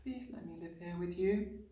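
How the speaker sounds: put-on high, soft little girl; small, hushed imploring voice; pleading child. A narrator's reading. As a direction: beg quietly and earnestly. Child begs teacher.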